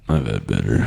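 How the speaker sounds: very low, quiet, unimpressed deity; comedy god. Pretend. Friend voicing an unimpressed comedy god, low deadpan. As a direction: drop way down into an underwhelmed god's voice. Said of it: "comedy god would be like this".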